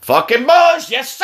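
high, loud outburst; exclaiming shout. Acted. Parent hearing who feeds kid candy, high shout. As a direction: holler it high, easing off in volume.

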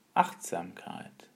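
low, soft whisper; whispered sage. Acted. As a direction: let the word fade to a whisper. Master repeats the word softly.

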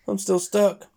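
high, sulky little pout. Acted. Stuck piglet complains to her friends, pouting and high. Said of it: "she pouts".

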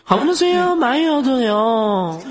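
put-on high, slow cutesy Korean-accented Mandarin; Korean drama girl accent. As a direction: mimic a simpering Korean drama actress. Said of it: "我给你模仿一下她们说话的口音啊".

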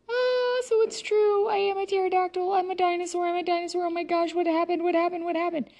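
put-on high, fast, frantic girl voice; panicked excitement. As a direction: rattle it off in alarm. She learns she's a pterodactyl.

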